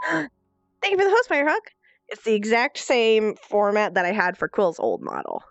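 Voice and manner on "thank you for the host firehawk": high excited squeal of thanks. Real, not acted. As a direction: burst out in high delight.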